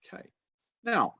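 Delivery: ordinary talk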